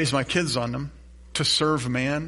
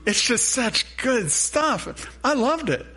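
excited; 9 semitones higher, slower than usual. Real, not acted. Gushing over the episode.

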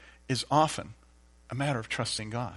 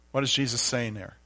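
ordinary talk, nothing marked

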